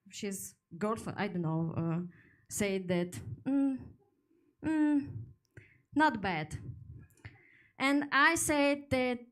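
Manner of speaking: normal talking voice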